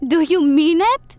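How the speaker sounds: high, loud, eager question; excited. Acted. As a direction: burst out with delight. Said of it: "set it down excitedly".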